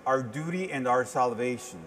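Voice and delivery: ordinary talk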